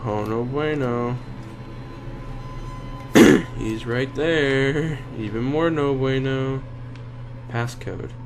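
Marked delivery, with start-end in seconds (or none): none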